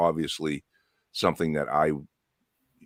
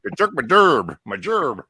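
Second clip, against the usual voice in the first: dopey blunt man voice; 8 semitones higher, 6 dB louder than usual. Retold or imitated. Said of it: "Blunt man says".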